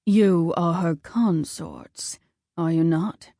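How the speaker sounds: slow, low commanding god; a lightly voiced stern war god; Ares voice. A narrator's reading.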